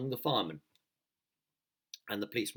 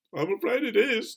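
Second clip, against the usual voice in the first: fearful police chief; 13 semitones higher, 8 dB louder than usual. Acted. Police chief voiced in story.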